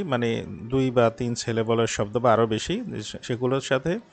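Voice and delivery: ordinary delivery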